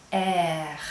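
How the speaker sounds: French letter R, low and deep in the throat; low-pitched throaty French letter name "air"; guttural French R. Shown as a demonstration. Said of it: "It's a guttural sound".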